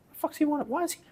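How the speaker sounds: rattled pitch jump on hearing who called; freaked-out squeak. Retold or imitated. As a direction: squeak it out in a panic. Panicking at Mark's call.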